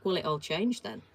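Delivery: usual speaking voice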